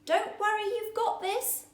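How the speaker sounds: high, cheerful reassurance; encouraging. Acted. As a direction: cheer him on. Tiny encourages.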